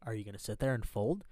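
usual speaking voice

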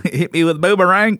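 high mock Australian accent; joking Australian twang. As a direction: play along in a broad Australian accent. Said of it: "take anyone with an Australian accent".

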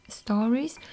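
ordinary talk

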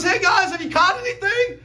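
high, loud call across the water; calling out from shore. Retold or imitated. Jesus calls out to the fishermen, friendly shout. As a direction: shout it over to the boat. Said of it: "He goes".

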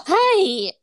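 high, loud adolescent squeak of a greeting; mock prepubescent boy. Joking with friend about her boyish voice, high mock-boy greeting. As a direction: do a prepubescent boy piping up loudly. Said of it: "are you into prepubescent boys?".

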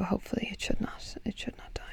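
hushed hopeful mutter; whispering. Real, not acted. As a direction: say it under your breath. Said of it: "whispered aside".

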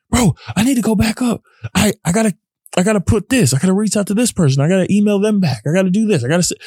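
high, hurried voice of the mind bully; racing inner voice. Retold or imitated. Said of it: "in my head, I'm like".

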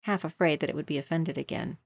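usual speaking voice

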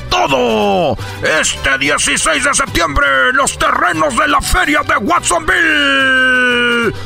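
ringmaster. Acted. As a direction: hype the crowd like a ringmaster. Announcing fiesta acts.

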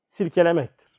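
usual speaking voice

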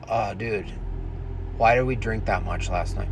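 low-key morning-after dude; accent dropped, tired. Acted. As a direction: play a hungover guy too tired to put on his accent. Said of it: "they probably lose the accent".

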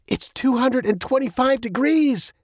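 high-pitched alarmed exclamation. A narrator's reading. Girl reads the dashboard temperature, high and alarmed. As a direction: exclaim it in rising panic.

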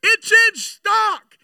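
high, slow triumphant yell; screaming. Retold or imitated. The woman running round the parking lot, screaming at full force. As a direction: shout it out over and over, slowly. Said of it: "and she's screaming".

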